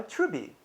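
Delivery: Truby ending on bee, no t; dropped final t. Shown as a demonstration. One Chinese person telling another to buy, t dropped from Truby. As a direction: say Truby with the t left off. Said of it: "they say".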